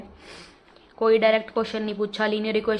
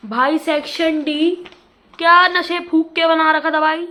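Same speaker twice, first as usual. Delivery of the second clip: loud, higher exasperated rant; exasperated outburst; 6 semitones higher, 8 dB louder than usual. Real, not acted. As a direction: blurt it out in disbelief. Outburst over the hard exam section.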